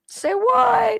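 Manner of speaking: high, stunned "say what"; incredulous. Retold or imitated. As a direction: squeak it out in disbelief. Imagined crowd reacting in disbelief.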